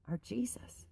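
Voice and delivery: hushed, breathless whisper; whispered name. Real, not acted. To her viewers, naming Jesus reverently in a whisper.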